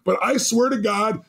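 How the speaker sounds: ordinary delivery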